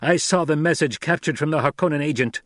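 high, loud protest; insistent accuser. Acted. Captor citing his evidence to the son, insistent. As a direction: argue the proof. Narrator voices Gurney arguing.

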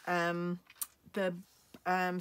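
ordinary talk

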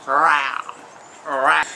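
low, short cat yowls; mock low-pitched rowr; imitated cat noise. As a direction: mimic an irritating cat down low. Imitates cats again.

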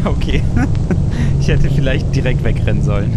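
loud, laughing through words; laughing while talking. Real, not acted. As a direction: talk through real laughter. Laughing after being spotted.

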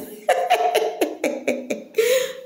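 long, high, breathless cackle; real burst of high laughter; laughing. Real, not acted. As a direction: crack up helplessly mid-commentary. Cracks up mid-commentary.

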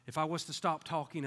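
ordinary delivery

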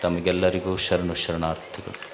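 low, soft, measured salutation with long pauses; quiet, low-pitched formal blessing; solemn invocation. Real, not acted. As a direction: open reverently, hushed and low, phrase by phrase. Opens discourse with invocation and salutation.

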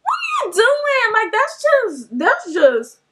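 high-pitched, exasperated retold protest; annoyed. Rider put on a stranger's camera, annoyed. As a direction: start rushed, then stretch it out in exasperation. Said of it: "At this point, I'm getting annoyed".

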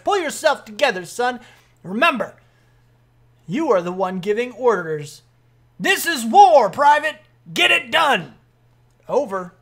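loud commanding military voice; rally the private with barked orders; stern sergeant, rallying. Acted. Sergeant telling a private this is war, forceful.

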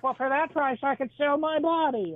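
put-on little old lady. Old lady haggling over price, high granny voice. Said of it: "She's like".

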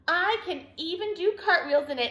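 high, happy boast; light whoop. A narrator's reading. Douglas boasting of cartwheels to friends, mild whooping. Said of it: "he whooped".

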